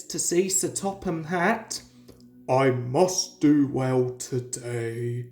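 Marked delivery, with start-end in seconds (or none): 2.5-5.3 low, slow big-engine voice; steady resolve under his breath; low, slow voice of a proud engine bracing himself; Gordon to himself. A narrator's reading. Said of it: "he said to himself".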